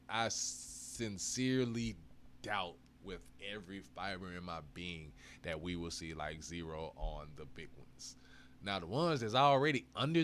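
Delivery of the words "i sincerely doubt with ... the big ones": slow, deliberate sincere promise; slow emphatic. Real, not acted. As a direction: lean on every word slowly. Slows for emphasis.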